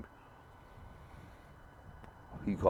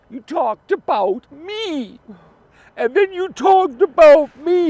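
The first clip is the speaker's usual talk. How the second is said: much higher, slow and loud, mocking the furious voice; imitating an angry rant; 14 dB louder, much slower than usual. Mocking a furious fellow YouTuber, much higher and louder. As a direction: ham up his rage in a high squeal. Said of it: "He was so angry".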